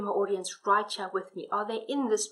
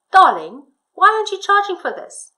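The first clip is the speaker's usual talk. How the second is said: loud, high husband's line; 9 semitones higher, 12 dB louder than usual. Shown as a demonstration. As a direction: call out the husband's question. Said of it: "when I do the dialogue, I'm still using my voice, slightly changing".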